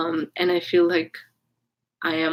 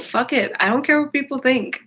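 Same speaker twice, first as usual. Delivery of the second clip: higher, defiant; a higher, fed-up burst; defiant outburst; 7 semitones higher than usual. Retold or imitated. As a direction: fling it off, done caring. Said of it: "I was like".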